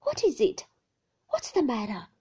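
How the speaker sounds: high, loud frightened question; alarmed cry. Acted. Wife alarmed as her husband paces, crying out. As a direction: call out to him in alarm.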